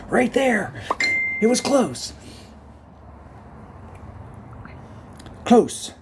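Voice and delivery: excited high. Real, not acted. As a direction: squeal the near miss. Excited near miss.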